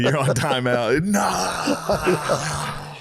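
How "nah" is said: high, mock pouting child; whiny no. Toddler refusing timeout, high whiny nah.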